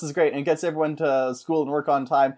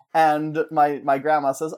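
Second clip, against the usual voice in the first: usual speaking voice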